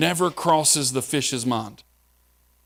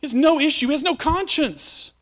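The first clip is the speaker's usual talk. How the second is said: preaching climax; 12 semitones higher than usual. Real, not acted. Preaching at full force.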